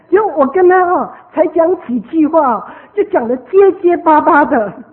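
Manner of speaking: loud exasperation. Real, not acted. Speaker telling listeners of stammering Taiwanese, loud exasperation. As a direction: complain loudly at your own failure.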